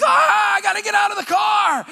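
panicked high shout; freaking out. Retold or imitated. Passenger suddenly desperate to get out of the car, freaking out. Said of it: "I, like, freak out".

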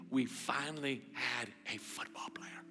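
hushed, tender whisper; soft intimate whisper to a son. Retold or imitated. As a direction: whisper it close to his ear.

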